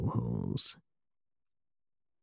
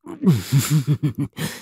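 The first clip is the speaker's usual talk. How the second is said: breathless laugh; 4 semitones higher than usual. Acted. Laughs while being choked.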